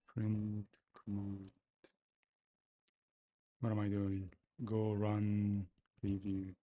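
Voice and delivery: ordinary delivery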